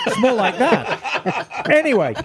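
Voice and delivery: slow words broken by laughter; slowed, giggly aside; laughing. Real, not acted. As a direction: still laughing as you say it. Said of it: "laughing after the bit".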